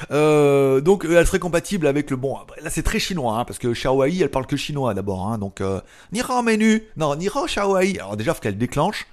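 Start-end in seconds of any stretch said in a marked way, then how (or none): 6.1-8.0 higher, slow, hammed-up Chinese accent; mock Chinese accent. Vlogger calls the Chinese assistant in a mock Chinese accent. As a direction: wake word in a put-on Chinese accent. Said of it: "Avec mon accent de psychopathe".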